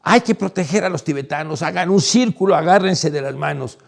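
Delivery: loud commanding call to the crowd; shouting instructions over a crowd; retold shout. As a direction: bark orders to form a circle. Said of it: "y entonces grito".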